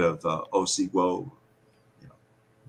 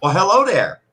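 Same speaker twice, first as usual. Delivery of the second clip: higher, louder, hammed-up Minnesota greeting; mock Minnesota accent; 11 semitones higher, 8 dB louder than usual. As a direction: do a cheery Fargo-movie Minnesotan. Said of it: "where they'd seen Fargo".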